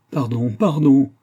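slow, high, loud plea; drawn-out acted plea; crying out. A narrator's reading. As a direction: cry out for forgiveness. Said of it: "s'écria Dournov".

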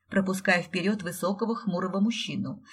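plain reading voice

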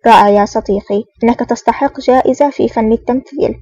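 ordinary delivery, nothing marked

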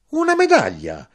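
very high, loud astonished question; cried out. A narrator's reading. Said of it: "mia madre gridò".

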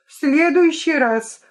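high, loud call-out; shouting from the car. A narrator's reading. Vika calls from the car to Lyolya, loud shout. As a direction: throw it back over the shoulder. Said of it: "уже из машины крикнула Вика".

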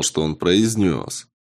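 plain reading voice

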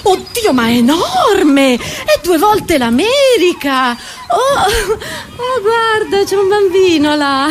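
high, slow swoops of astonishment; amazed. Acted. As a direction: gasp it out high and stretched. Acted amazement at approaching island. (meraviglia recitata, acuta e allungata)

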